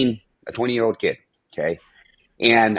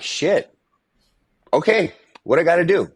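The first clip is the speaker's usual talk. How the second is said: a sudden, pitched-up "Okay, what I gotta do?" rushing out, hooked; eager excitement; 7 semitones higher than usual. Retold or imitated. Young man to his mentor, eager and fired up. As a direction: grab the chance, eager to start now.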